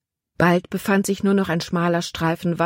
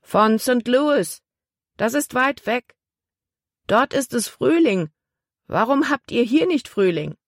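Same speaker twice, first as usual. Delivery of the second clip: put-on a small girl puzzling aloud; girl's voice; 7 semitones higher than usual. A narrator's reading. Girl keeps talking from shore. (hohe, kindliche Mädchenstimme, staunend)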